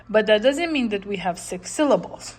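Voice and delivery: usual speaking voice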